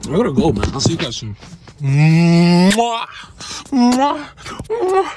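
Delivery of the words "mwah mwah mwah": rising smooches; kiss sounds. Real, not acted. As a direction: blow loud kisses. Sign-off kisses.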